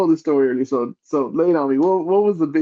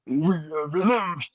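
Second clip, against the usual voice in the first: Venom voice; 2 semitones higher, 5 dB quieter, slower than usual. Retold or imitated. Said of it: "Say that voice one more time".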